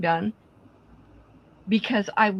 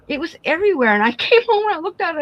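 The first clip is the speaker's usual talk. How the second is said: laughing disbelief; 10 semitones higher, 4 dB louder, much faster than usual. Real, not acted. Laughing disbelief retelling the mess.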